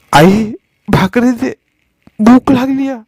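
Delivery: put-on a hungry little boy begging his mother; pleading child. A narrator's reading. Hungry son begging his mother for bread, whimpering. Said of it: "सुन्या कळवळला".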